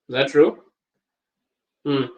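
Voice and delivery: ordinary talk, nothing marked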